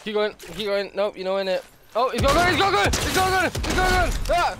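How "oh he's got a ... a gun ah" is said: high, loud play-acted alarm; mock panicked shouting. Player spots a gun in a game, panicked shout. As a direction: cry out a frantic pretend warning.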